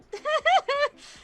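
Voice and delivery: high anxious giggle; nervous laugh. Real, not acted. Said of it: "there's the nervous laugh again".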